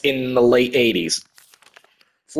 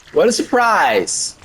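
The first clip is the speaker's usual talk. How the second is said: sarcastic wow; 6 semitones higher, slower than usual. Pretend. Dead bodies everywhere, deadpan.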